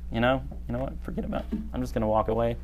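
mock sulk, soft and dismissive, ending in a sigh; sulky pout. Someone prone to pout walks off, acted sulky and quiet. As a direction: act out giving up in a soft huff. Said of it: "I am prone to sulk and pout".

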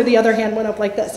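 ordinary talk

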